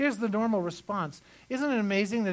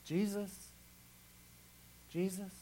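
softer and softer, trailing off; fading call; 7 dB quieter than usual. Acted. Pastor softly repeating the name Jesus in trouble, fading. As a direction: let the name fade to a murmur.

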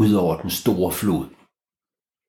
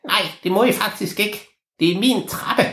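high, squeaky little troll guarding his stairs; a tiny creature defending his staircase, voiced high; 12 semitones higher than usual. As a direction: pitch it way up like a tiny stubborn goblin.

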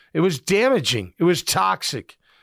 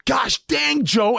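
high, sharp angry yell; angry outburst; 7 semitones higher, slower than usual. Retold or imitated. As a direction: snap at the coach. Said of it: "And Vaughn snapped".

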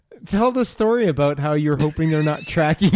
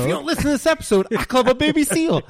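hyped promo voice; 9 semitones higher, faster than usual. Acted. Mock promo voice after intro.

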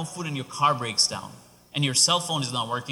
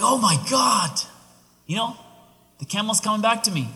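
high, loud burst of joy; overjoyed; 7 semitones higher, 4 dB louder than usual. Acted. Traveler sees his lost camel returning, overjoyed. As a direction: exclaim it with delighted relief. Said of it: "He's so happy".

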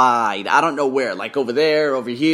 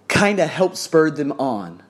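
normal talking voice

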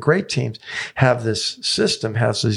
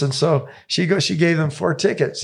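ordinary delivery

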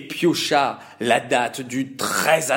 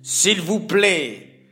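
hateful voice; 5 semitones higher, 4 dB louder than usual. Acted. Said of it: "déclara Aman d'une voix haineuse".

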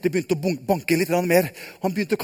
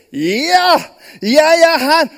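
calling back; 6 semitones higher, 6 dB louder, much slower than usual. Acted. Daniel answers from the den.

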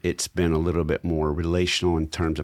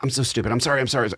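daughter apologizing; 7 semitones higher, faster than usual. Retold or imitated. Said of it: "She'll go".

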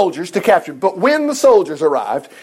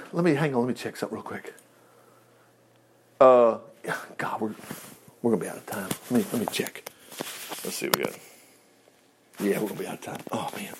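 low, very soft aside; muttering to self; 10 semitones lower, 10 dB quieter, faster than usual. Real, not acted. Preacher checking whether time is running out, muttering to self. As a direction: think aloud under your breath.

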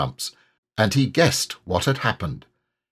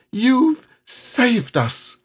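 high, very slow and amazed; admiring Piglet; 14 semitones higher, much slower than usual. A narrator's reading. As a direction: marvel at your friend.

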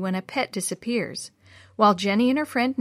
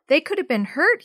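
usual speaking voice

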